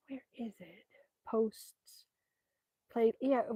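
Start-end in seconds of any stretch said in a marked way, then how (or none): 0.1-2.0 whisper. Real, not acted. Reading to herself while searching.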